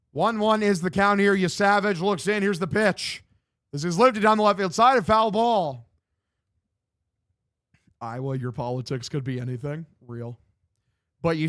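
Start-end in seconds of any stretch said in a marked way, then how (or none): 0.1-5.9 higher, projected baseball call; live play-by-play. Real, not acted. Streamer calling the game for viewers, play-by-play voice. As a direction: call the pitch like a radio announcer.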